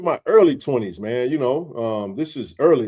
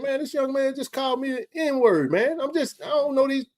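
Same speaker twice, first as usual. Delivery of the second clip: high, indignant telling-off; retold heated complaint; 11 semitones higher, 3 dB quieter than usual. Telling the restaurant lady what happened, indignant. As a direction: vent to her, still fuming. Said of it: "I was hot".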